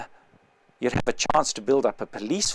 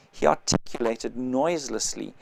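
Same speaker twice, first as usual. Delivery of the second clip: ordinary delivery, nothing marked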